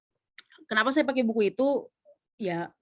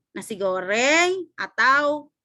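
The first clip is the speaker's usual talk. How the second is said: slow 'Nasi goreng, atau' climbing and left open; drawn-out options, rising on 'goreng'; rising option intonation; 3 semitones higher, 5 dB louder, slower than usual. Shown as a demonstration. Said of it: "intonasinya kayak gini".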